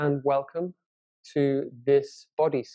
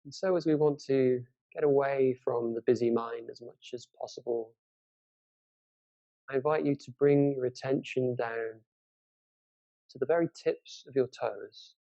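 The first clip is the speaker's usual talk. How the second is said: guided meditation. Acted. Teacher leads listeners through a body scan from the toes, guided meditation. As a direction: guide them unhurriedly through a body scan, leaving space between phrases.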